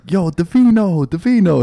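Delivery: calling out. Retold or imitated. Friends calling out in park.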